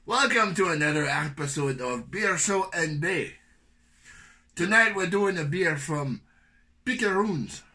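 high, loud mock Irish host; put-on Irish accent. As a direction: ham up an Irish brogue for the intro. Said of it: "I might as well have the accent".

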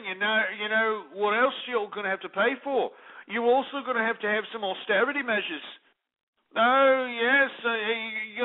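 mock pompous royal-watcher. Mocks royal wedding, held raised voice.

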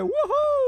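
high-pitched "woohoo" cheer; soaring playful whoop. Real, not acted. Cheering own jump in game.

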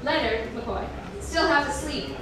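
ordinary delivery

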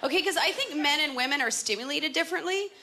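ordinary talk

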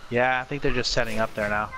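ordinary talk, nothing marked

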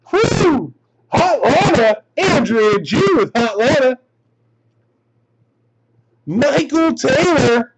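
high whoop into thrilled card calls; carried away by a great find; excited. Real, not acted. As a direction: whoop and announce it with real excitement. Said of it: "Sorry, I got all excited there".

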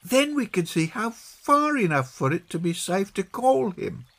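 put-on high child's voice, working out a plan; Peggy. Peggy planning the search with John, high child voice.

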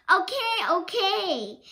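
high loud okay okay; laughing protest. Acted. Maddie fending off the licking dog, high and loud. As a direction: fend off an eager licking dog.

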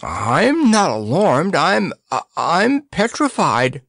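high, drawn-out, stammering fright; petrified gasp. A narrator's reading. Percy facing a talking head, petrified gasp. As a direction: gasp it out slowly, scared stiff. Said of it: "gasped Percy".

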